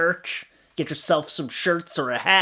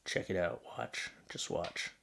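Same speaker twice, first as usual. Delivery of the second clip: breathy near-whisper, very soft; whispered watch; 10 semitones lower, 16 dB quieter than usual. Real, not acted. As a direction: hush and point it out. Hushed while watching clip.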